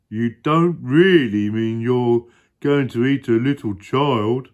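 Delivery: high, loud, appalled hippo; Humpy Rumpy. Acted. Hippo appalled at the crocodile's plan, high and loud. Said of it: "said Humpy Rumpy".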